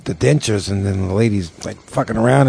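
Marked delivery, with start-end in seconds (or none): none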